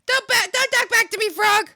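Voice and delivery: loud, high-pitched shout; playful full-volume telling-off; mock scolding. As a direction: yell it like a fed-up parent.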